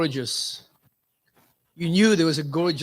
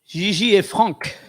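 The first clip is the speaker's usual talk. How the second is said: put-on French accent; 3 semitones higher, 6 dB louder, slower than usual. Says the names the French way.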